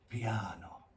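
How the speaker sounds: very quiet, low voice; tiny, soft demonstration; piano, soft. Shown as a demonstration. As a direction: hush right down for pupils to copy. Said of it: "soft or quiet".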